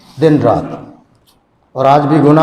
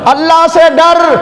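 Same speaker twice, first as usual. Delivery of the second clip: much higher and louder, a desperate cry; cried out plea; 13 semitones higher, 4 dB louder than usual. Acted. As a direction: plead aloud, as if begging for mercy. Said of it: "پھر کہو". (اونچی آواز میں فریاد)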